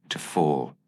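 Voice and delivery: ordinary delivery, nothing marked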